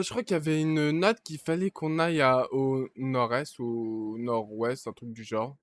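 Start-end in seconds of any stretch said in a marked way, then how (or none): none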